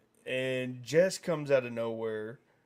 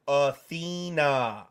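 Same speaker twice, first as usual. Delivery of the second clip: Athena slow and louder, each syllable drawn out; enunciated name; 5 dB louder, slower than usual. Retold or imitated. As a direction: stretch out Athena very clearly. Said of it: "You could enunciate".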